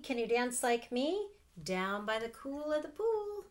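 put-on reading to children, building. A narrator's reading. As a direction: let the excitement build line by line. Aunt reads picture book aloud.

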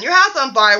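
ordinary talk

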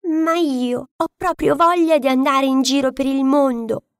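a little duck eager to roam; longing duckling. A narrator's reading. Duckling tells the hen his wish, lightly voiced. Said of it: "Il brutto anatroccolo disse".

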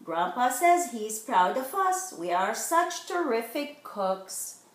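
ordinary delivery

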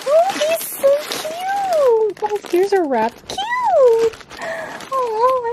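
very high, excited squeal; cute squeal. Real, not acted. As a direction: squeal over something adorable. Gushing over cute gifts.

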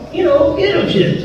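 high-pitched imitation of a Puerto Rican friend; Puerto Rican accent. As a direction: answer as your Puerto Rican buddy, high-voiced. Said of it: "And that Puerto Rican accent of his".

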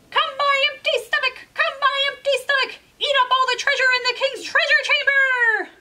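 high, loud rooster chant; rooster calling out. Acted. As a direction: summon the magic stomach like a spell. Rooster calls on his stomach.

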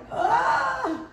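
high, drawn-out mock cry of fright; panicked cry. Retells panicking alone among explosives.

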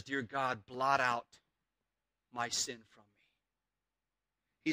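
low, soft and very slow, barely above a breath; hushed prayer. Acted. Preacher voicing David's hushed low plea to God, slow and soft. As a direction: pray it quietly, as a broken man begging. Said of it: "And David says".

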